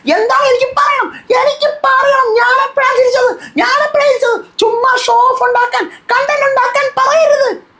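very high, squeaky mock voice, drawn out; shrill-pitched parody of the angry housemate; mocking Rena. Vlogger mocking Rena demanding when she laughed, squeaky high parody.

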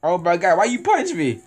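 high, loud shocked complaint; indignant. Real, not acted. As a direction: cry out in outraged surprise. Just got punched in game.

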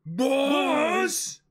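high, loud call in a different voice. Acted. Henchman calling to his boss, loud high call. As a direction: cry out to the boss. Crewmate calls out.

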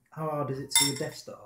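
between mouthfuls of cereal; chewing while speaking; talking while eating cereal. Real, not acted. Seen 1: Spoon in bowl, eating cereal while talking, head down.

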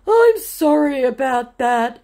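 high, loud, slow mock apology; put-on scammer voice, drawn-out sorry; scammer giving up. Scammer giving up after being caught, high mock apology. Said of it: "just giving it up".

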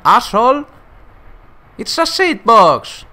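put-on high, loud outburst in an accent; imitated film rant, last words stretched out; Daniel Brühl as Niki Lauda. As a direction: yell it high like an accented movie character. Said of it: "El acento lo pone calcao".

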